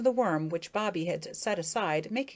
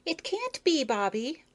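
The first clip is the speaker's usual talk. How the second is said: higher, lighter female beetle voice; a coy young lady beetle; Effie, high voice; 7 semitones higher than usual. A narrator's reading. To Bobby, gently refusing in a higher voice.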